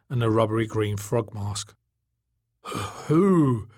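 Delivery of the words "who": high, gasped question; gasping. A narrator's reading. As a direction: gasp it out as a startled question. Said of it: "he gasped".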